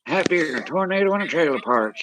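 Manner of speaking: high cartoon yokel voice; silly high voice. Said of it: "I can't do voices like you do".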